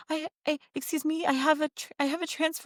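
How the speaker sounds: high, hesitant imitation of a pleading traveler; anxious passenger. Traveler imitates passenger behind fearing a missed transfer, high and apologetic. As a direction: pitch it up, polite and stumbling.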